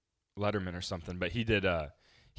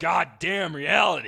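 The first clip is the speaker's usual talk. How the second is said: loud, slow, disgusted grumble; grumpy growl; 5 semitones higher, 6 dB louder, slower than usual. Pretend. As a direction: curse reality like a grouch. Mocking cranky woken-up friend.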